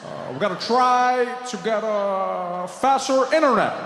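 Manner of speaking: slow, halting, stop-start; mock Obama with long pauses; buffering Obama. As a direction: stall like a buffering video. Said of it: "sounded like his voice was buffering".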